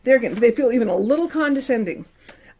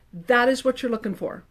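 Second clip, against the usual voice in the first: usual speaking voice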